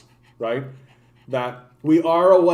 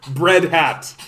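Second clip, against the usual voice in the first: ordinary talk, nothing marked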